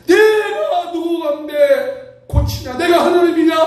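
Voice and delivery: high, drawn-out cry of an alarmed king; panicking king. Acted. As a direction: wail it in mock panic, slow and high. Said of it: "벌벌 떠는 이스라엘 왕의 모습". (겁에 질린 왕처럼 높게 늘여 외치기)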